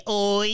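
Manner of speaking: the sound «oi» said high and loud the Québécois way. Shown as a demonstration. Listing funny Québécois sounds, Québécois «oi» demo. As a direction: pop out «oi» like a Québécois.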